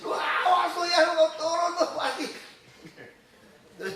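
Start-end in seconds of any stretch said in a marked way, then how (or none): 0.0-2.3 very high, loud scolding shout; retold panicked yelling. Driver showing how other parents react, panicked shouting. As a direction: yell up in alarmed outrage.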